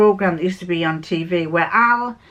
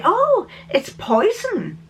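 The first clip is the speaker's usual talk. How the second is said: imitating a customer; 10 semitones higher, much slower than usual. Customer names her perfume.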